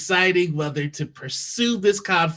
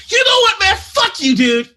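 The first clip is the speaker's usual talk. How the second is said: loud, much higher yell; furious outburst; 12 semitones higher, 8 dB louder than usual. Acted. Stressed office worker yells at pushy customer, furious. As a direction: blow up at him, all pressure released. Nine-to-fiver finally explodes.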